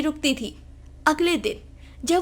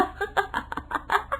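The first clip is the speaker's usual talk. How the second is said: stifled giggle; 8 semitones higher, 8 dB quieter than usual. Acted.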